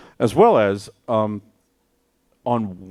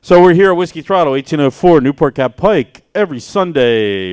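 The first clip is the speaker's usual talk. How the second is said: hype announcer; 5 semitones higher, 11 dB louder than usual. Acted. Re-announcing the venue and time.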